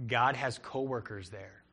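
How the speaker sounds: ordinary talk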